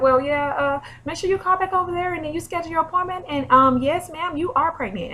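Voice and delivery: high, excited phone-clerk voice; clinic receptionist. Retold or imitated. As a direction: imitate a receptionist bursting to share good news. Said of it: "She was like".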